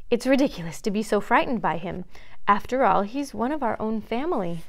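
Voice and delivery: plain reading voice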